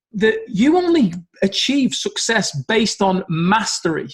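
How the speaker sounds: ordinary delivery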